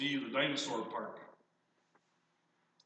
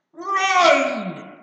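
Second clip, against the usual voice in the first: put-on much higher, loud child's yell; yelled warning; 18 dB louder than usual. Little boy fleeing the dinosaur yells to his parents, panicked yell. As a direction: scream it like a fleeing toddler. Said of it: "looks back and yells".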